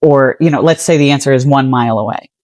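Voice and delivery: ordinary talk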